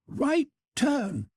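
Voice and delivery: high, restrained shouted order; shouting. A narrator's reading. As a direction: call a marching order high, without much volume. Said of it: "I heard Montgomery's voice shouting".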